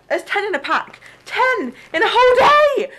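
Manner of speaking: outraged disbelief; shocked. Real, not acted. Shocked at pad count.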